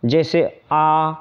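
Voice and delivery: आ held long and slow; long vowel demo. Shown as a demonstration. Teacher to students showing a long vowel, drawn out. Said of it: "ये इसके उदाहरण हो गए दीर्घ स्वर के".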